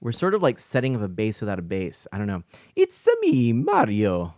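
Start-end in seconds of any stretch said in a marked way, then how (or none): 2.8-4.3 Chris Pratt as Mario. Acted.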